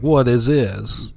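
slow, strange alien voice. Pretend. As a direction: drawl it out like a confused alien. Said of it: "Alien voice".

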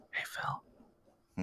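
breathy seductive whisper; ASMR whisper. Pretend. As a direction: whisper it close to the mic. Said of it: "let me try".

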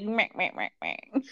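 greedy villain cackling over cash; mustache twirling villain. Retold or imitated. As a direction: gloat like a cartoon tycoon. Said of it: "corrupt C E O twirling his mustache".

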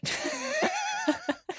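squeaky high-pitched laugh. Real, not acted. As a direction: burst into a high giggle. Laughing at Trolley Witch joke.

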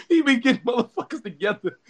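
words spilling out through laughter; laughing. Real, not acted. Praising Shannon Sharpe to co-host, laughing. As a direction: laugh while praising him.

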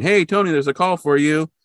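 mock shout to a colleague across the room; calling out. Staffer telling Tony he has a call, calling out.

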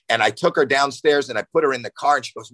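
ordinary talk, nothing marked

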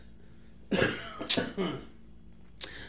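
hacking, phlegmy cough; coughing fit. Real, not acted. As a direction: cough hard, clearing the chest. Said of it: "I keep coughing stuff up".

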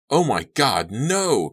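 high, loud scream of horror; terrified scream of no. A narrator's reading. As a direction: scream at the top of your lungs. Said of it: "Kerry screamed at the top of his lungs".

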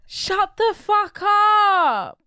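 high, loud, drawn-out yell; hammed-up furious telling-off; exasperated shout. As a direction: stretch it into a big fed-up holler. Acted telling-off of a man.

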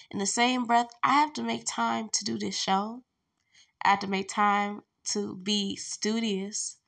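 usual speaking voice

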